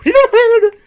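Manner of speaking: loud, very high squeal; silly high-pitched creature cry; lizard noise. Pretend. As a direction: shriek like a scampering lizard. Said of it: "exactly the noise lizards make".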